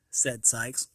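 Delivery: plain reading voice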